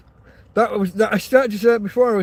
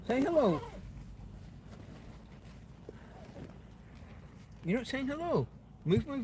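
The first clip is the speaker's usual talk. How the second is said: talking to dog; 3 semitones higher, 10 dB quieter, faster than usual. Real, not acted. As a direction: owner coaxing dog onto the bed.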